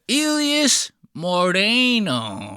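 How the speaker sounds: put-on slow, high, drawn-out Spanish-accented name; exaggerated Spanish accent, stretched out; mocking Spanish accent. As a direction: mimic an overdone Spanish pronunciation. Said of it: "he didn't even say my name right".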